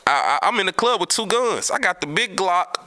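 ordinary delivery

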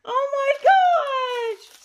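drawn-out, very high squeal; squeaking with delight, vowels stretched long; squeaky voice. Real, not acted. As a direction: gush in a slow, squeaky squeal. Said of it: "my voice is really squeaky today".

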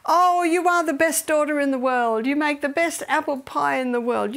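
imitating gushing fatherly praise. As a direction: lay the flattery on thick. Husband gushing over daughter's pie.

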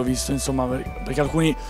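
ordinary talk, nothing marked

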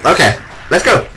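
ordinary talk

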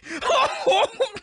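high laughing squeal; high shouted laugh. Acted. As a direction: shriek with laughter while being tickled. Interrogated with a little of this.

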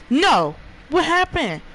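shout, then ask in disbelief; jumpy shocked outburst; startled yell. Real, not acted. Sudden scare, then confusion.

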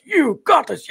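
high, far-off mock Piccolo coaching yes yes; Piccolo Funimation dub. Streamer mocking the Funimation Piccolo dub, high mock voice. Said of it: "he was like".

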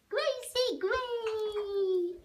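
very slow, stretched greeting; drawn-out happy call. Real, not acted. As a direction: sing out the bird's name. Greeting a pet bird.

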